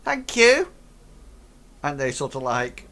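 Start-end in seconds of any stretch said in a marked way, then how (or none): 0.0-0.7 high, chirpy goblin thanks. Acted. Goblin thanking the players before leaving. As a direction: chirp a quick thank you. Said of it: "They go".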